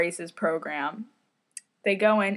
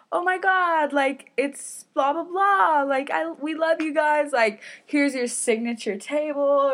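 gushing restaurant staff; 6 semitones higher than usual. Retold or imitated. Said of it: "they're like".

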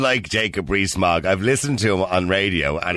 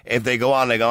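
usual speaking voice

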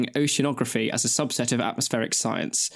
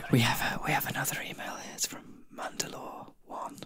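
whisper; 9 dB quieter than usual. Acted. Whispering the intro to a listener email. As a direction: hush it like a secret.

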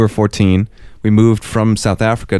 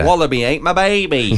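higher-pitched hammed-up Aussie; mock Australian accent; 6 semitones higher than usual. Riffing on Aussie lingo with co-host, hammed-up Australian accent. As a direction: ham up a silly Australian accent. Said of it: "they got a cool accent".

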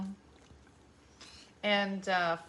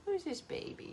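high, soft coo; 10 semitones higher, 10 dB quieter than usual. Real, not acted. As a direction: coo softly and high to a pet. Cooing baby talk to the dog.

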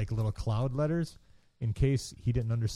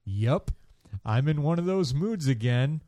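usual speaking voice

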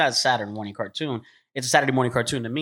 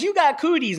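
mock kid taunt; 12 semitones higher than usual. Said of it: "Kids will tell their brothers".

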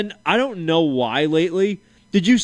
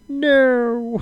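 a higher, hammed-up wail of no; mock despairing no; 8 semitones higher than usual. Quoting a comedian's skit to a co-host, a mock wail. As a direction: moan it like it's the end of the world.

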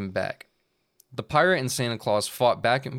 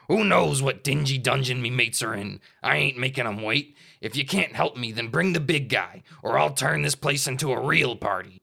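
loud pirate shout, a bit higher; 3 semitones higher, 4 dB louder than usual. Acted. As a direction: bellow it like a pirate captain. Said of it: "Driftwood shouted for all to hear".

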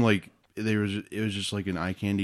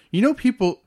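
exasperated; 3 dB louder than usual. Acted. Exasperated lecture.

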